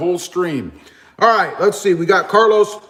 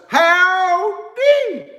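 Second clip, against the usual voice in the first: high, long drawn-out "Howdy"; drawled howdy; 13 semitones higher than usual. Shown as a demonstration. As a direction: sing out "Howdy" slow like a cowboy. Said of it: "That's how they say it".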